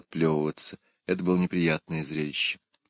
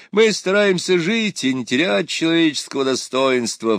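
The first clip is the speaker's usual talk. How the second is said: hammy stage actor; 8 semitones higher, 5 dB louder than usual. A narrator's reading. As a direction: play it to the gallery. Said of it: "театрально воскликнул Пыркин".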